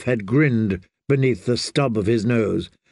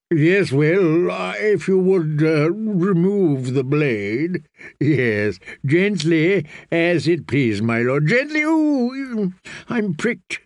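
a pampered eunuch pleading for gentleness; fussy eunuch; 4 semitones higher, slower than usual. Acted. Narrator voices Varys.

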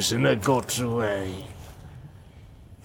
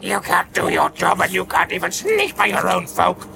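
furious orc rant; 6 semitones higher than usual. Acted. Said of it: "his voice was full of fear and rage".

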